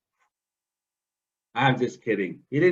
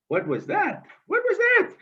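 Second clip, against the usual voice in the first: higher, rising to a frightened squeak; startled fright. Acted. Muskrat swimming in the dark, frightened high squeak. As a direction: jump at a shadow in the dark water.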